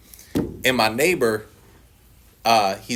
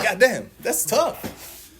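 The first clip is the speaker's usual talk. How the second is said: slow, stretched, higher god damn; drawn-out dismay; 9 semitones higher, much slower than usual. Retold or imitated. A newly divorced man groaning at sober life, slow and dragged. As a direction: drag it out like it just hit you. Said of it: "I'm like".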